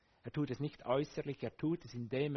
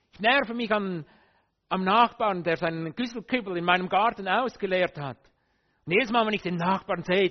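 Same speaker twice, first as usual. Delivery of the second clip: acted anger at neighbour; 6 semitones higher, 11 dB louder than usual. Voicing own grudge at neighbour.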